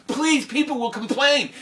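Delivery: high, loud alarmed protest; high anxious objection; protesting cook. Acted. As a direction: cook pleading against the order. Cook pleads.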